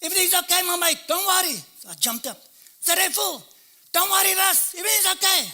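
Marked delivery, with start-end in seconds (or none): 0.0-1.6 high, loud call across a yard; shouted call. Retold or imitated. Afrikaans mate reassuring a jailed friend from afar, loud high shout. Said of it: "was shouting from the soccer ground". 2.8-5.5 high, loud call across a yard; shouted call. Retold or imitated. Afrikaans mate reassuring a jailed friend from afar, loud high shout. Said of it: "was shouting from the soccer ground".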